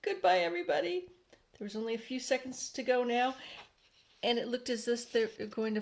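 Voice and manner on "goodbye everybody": lightly voiced farewell. End of previous chapter, farewell.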